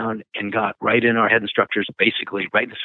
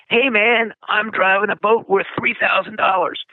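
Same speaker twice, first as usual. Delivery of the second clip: put-on much higher, eager kid bragging; excited boast; 12 semitones higher than usual. Student at the wheel bragging to instructor, excited high voice. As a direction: crow about it like a thrilled teenager.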